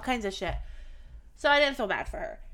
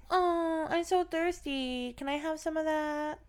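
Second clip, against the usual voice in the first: mocking a whiny kid, higher, slow; childish whine; 7 semitones higher, 5 dB quieter, slower than usual. Mocking the granddaughter begging for a drink, childish whine. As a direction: imitate a teen acting like a baby. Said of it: "she's talking like she's a child".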